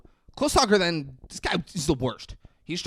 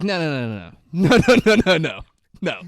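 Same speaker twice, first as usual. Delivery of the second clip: emphatic no; 3 semitones higher, 8 dB louder than usual. Retold or imitated. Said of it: "so he's like".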